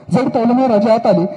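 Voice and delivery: normal talking voice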